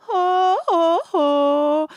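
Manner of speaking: high falsetto oh, breaking like the singer; imitating his falsetto flips. Vocal coach imitating the singer's flips, high falsetto oh. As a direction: mimic the little voice breaks on oh. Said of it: "para que le salga ese oh".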